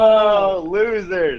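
high, gloating jeer; taunting. Pretend. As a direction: rub it in playfully. Taunting the others playfully.

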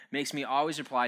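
ordinary talk

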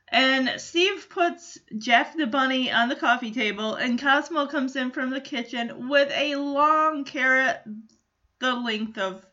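normal talking voice

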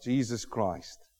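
usual speaking voice

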